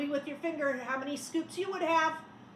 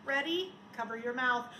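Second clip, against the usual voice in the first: usual speaking voice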